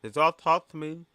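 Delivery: loud, high, garbled by ice; like talking with a retainer in; mouth full of ice. Real, not acted. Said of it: "I sound like a bitch with a retainer in her mouth".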